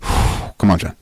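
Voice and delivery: sighing, pulling himself together; steadying his voice through emotion; choked up. Real, not acted. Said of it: "I get a little emotional".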